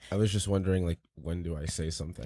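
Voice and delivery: quiet, groggy hungover voice. Real, not acted. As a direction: sound rough and hungover. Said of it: "you sound really hungover".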